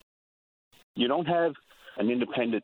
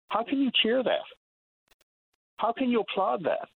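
exasperated disbelief; 10 semitones higher than usual. Real, not acted. Outraged rhetorical questions on radio.

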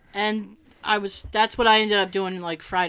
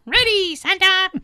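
higher, louder cartoon cheer; 7 semitones higher, 4 dB louder than usual. A cartoon reindeer answering Santa, high and loud. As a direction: shout it back like a cartoon reindeer. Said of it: "uh, cartoon".

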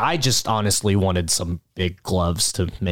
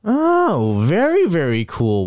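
impressed; 7 semitones higher, slower than usual. Acted. Acted reaction to an introduction.

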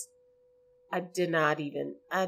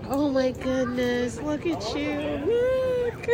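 squealing with joy; 10 semitones higher than usual. Real, not acted. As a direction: gush over the son you missed. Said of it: "that I was squealing".

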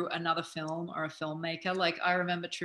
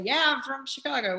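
casual American accent, raised pitch; 8 semitones higher, 4 dB louder than usual. Shown as a demonstration. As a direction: say it like a local American. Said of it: "talk to people in an American accent".

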